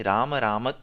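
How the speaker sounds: राम राम with a clear push on the closing म, slow; stress on final म. Shown as a demonstration. Teacher models राम राम द्वयम वदेत for the student, stressing म. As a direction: land on the म at the end of each राम. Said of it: "अंत में म पे जोर दिया".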